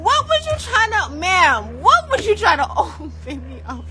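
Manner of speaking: high, loud incredulous protest; mock indignant disbelief. Telling viewers how she questioned the doctor, high incredulous protest. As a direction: ask in outraged disbelief.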